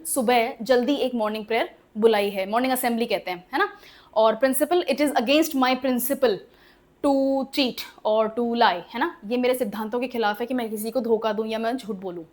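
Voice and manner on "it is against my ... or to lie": very slow, word by word; slow example read. Shown as a demonstration. Teacher dictating an example sentence to the class, very slow. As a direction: dictate it so students can write it down. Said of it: "teacher reads example sentence slowly".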